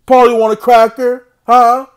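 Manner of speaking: loud sing-song mockery of a chattering bird; mocking taunt. Real, not acted. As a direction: tease him like a pet parrot. Mocking Paulie like a parrot.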